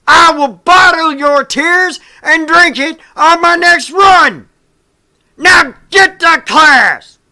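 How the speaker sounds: drill sergeant bellow. Acted. Sergeant threatening the recruit before class, furious drill-sergeant shout. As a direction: roar every word down his throat.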